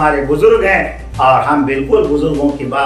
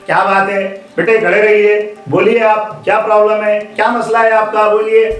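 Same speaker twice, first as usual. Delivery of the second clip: higher-pitched, encouraging questions; coaxing questions; 7 semitones higher than usual. Acted. Leader to monkey child, coaxing out the problem. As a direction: coax a little one to speak up.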